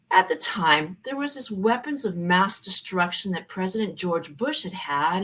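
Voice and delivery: ordinary talk, nothing marked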